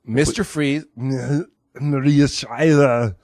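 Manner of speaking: put-on slow, drawn-out Austrian accent; Arnold Schwarzenegger. Comic as Schwarzenegger naming his wife, Austrian accent. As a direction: stretch the names in thick Austrian. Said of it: "you have an Austrian accent".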